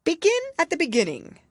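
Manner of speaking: grave king. A narrator's reading. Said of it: "the king said gravely".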